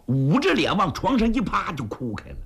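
ordinary delivery

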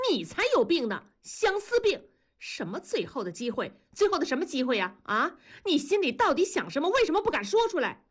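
high, fast, heated interrogation; angry retort. A narrator's reading. Wife demands husband admit his feelings, heated. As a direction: fire back, pitch climbing to the end.

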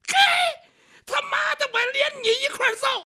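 furious shout. Acted. Ruffian threatens to beat the scholar too, furious shout. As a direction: explode at him, ready to swing.

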